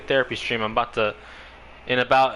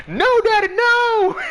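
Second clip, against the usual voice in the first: put-on shrieking, much higher and louder, like a child begging; mock kid scream; 8 dB louder, slower than usual. Retelling the movie, son being whipped by dad, high mock scream. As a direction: squeal it like a kid about to get the belt. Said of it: "all you hear is".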